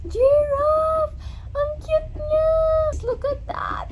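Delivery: high, loud delighted squeal; excited squeal. Real, not acted. Vlogger unboxing a giraffe figure, excited squeal. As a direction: squeal with delight, stretching the words.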